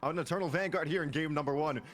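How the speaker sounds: high-pitched live esports call; excited play-by-play. Real, not acted. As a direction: build into a drawn-out first blood announcement.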